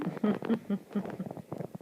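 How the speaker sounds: whispered wicked laugh; a soft, sneaky snicker; sly breathy chuckle. Acted.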